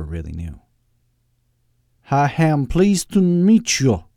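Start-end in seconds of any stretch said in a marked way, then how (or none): 2.1-4.0 put-on loud, slow accent sliding from Southern to Austrian; wandering Southern-Austrian accent. Doctor Loeb meeting his guests, wandering Southern-Austrian accent. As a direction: greet in a muddled Southern-Austrian villain accent. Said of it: "accent that wandered from Southern to Austrian".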